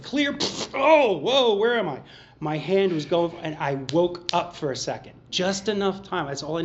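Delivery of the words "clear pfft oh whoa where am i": jolted awake, much higher and louder; startled awakening. Retold or imitated. Waking from the craving just before grabbing the beer, startled. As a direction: gasp and come to with a start.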